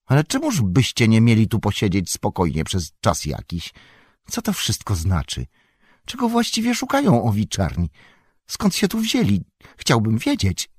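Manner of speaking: fretful questions, pitch jumping high; fussy, rattled landlord demanding answers; anxious innkeeper. A narrator's reading.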